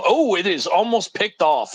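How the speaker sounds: excited. Real, not acted. Near interception in play-by-play.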